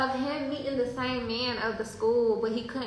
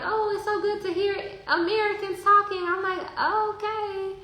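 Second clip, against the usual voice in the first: high-pitched imitated small talk; retold chat; 8 semitones higher than usual. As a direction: lift the quote up high. Retells man's greeting and own reply.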